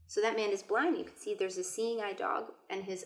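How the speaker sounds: ordinary delivery, nothing marked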